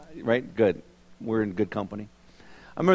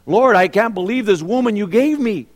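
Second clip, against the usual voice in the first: higher, whiny complaint; mock whining husband; 6 semitones higher than usual. Husband griping to God about his wife, mock whine.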